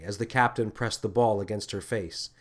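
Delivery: ordinary delivery, nothing marked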